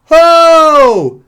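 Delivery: loud, high Ho! Ho! to grab her attention; snapping shout. Retold or imitated. Thirsty customer yelling at bored waitress, loud high shout. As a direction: bark it across the restaurant, high and loud. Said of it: "So I snap".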